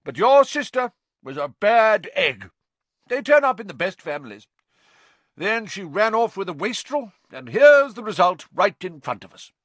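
very high, loud, scornful gossip; loud, nasty aunt insulting the sister; spiteful Aunt Marge. A narrator's reading. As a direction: twist the knife in front of the boy. Marge insults Harry's mother.

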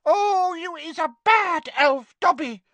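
very high, loud wailing elf; tearful, anguished little creature; moaning. A narrator's reading. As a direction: moan the reproach in a squeak. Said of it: "moaned Winky".

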